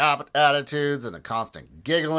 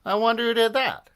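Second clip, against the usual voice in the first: wondering fan; 12 semitones higher, 5 dB louder than usual. Acted. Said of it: "asked another fan".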